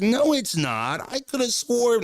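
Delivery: mock protest, rising up high; imitating a girl. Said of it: "when a girl try to talk about shit".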